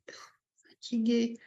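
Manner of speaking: puffed, whispery, slow sigh of fatigue; worn-out, drawn-out delivery; acted tiredness. Shown as a demonstration. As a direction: blow out air and sag into the word. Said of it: "les expressions du visage, c'est hyper important".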